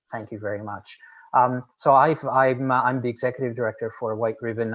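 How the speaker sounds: ordinary talk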